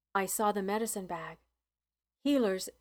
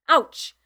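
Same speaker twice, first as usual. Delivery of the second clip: sharp ouch; 6 semitones higher, 11 dB louder than usual. A narrator's reading. Narrator pinches herself.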